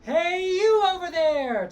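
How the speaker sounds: high loud slow call, hey you over there. Shown as a demonstration. Coach demonstrates calling to someone far away. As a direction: call far across a field. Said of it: "Try that".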